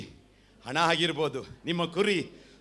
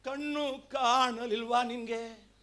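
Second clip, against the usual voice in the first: higher, softer imitation of a whining believer; mock complaining prayer; 8 semitones higher, 5 dB quieter, slower than usual. Preacher mimicking a believer demanding blessings, mock whine. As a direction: lightly mimic a grumbling prayer to God. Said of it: "ನಾವೇನ್ ಮಾಡ್ತೀವಿ?".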